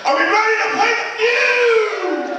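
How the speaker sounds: high game show host call; hype shout. Real, not acted. Host calling out to crowd.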